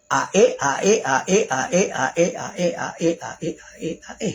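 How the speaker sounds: low, even vowel repetitions tailing away; quick repeated pronunciation. Shown as a demonstration. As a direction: rattle off the vowels as an easy demonstration. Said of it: "napakadali natin i-pronounce".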